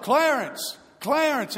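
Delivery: high, loud calling out Clarence; yelled name. Retold or imitated. Preacher yelling to the corpse in the casket, loud. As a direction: call the dead man by name, loud and high. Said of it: "He just yelled".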